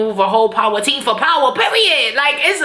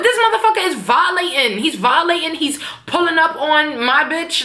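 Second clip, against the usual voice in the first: outraged Tommy; 4 semitones higher than usual. Retold or imitated. Re-enacting Tommy's gripe about Sax, outraged. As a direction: mimic a wronged man's rant. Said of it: "'Cause that's how we be talking".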